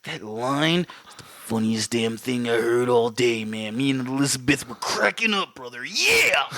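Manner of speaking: a hint of a gravel-throated wrestler promo; mild Macho Man wrestling promo impression; Macho Man Savage voice. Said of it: "I can hear Macho Man like".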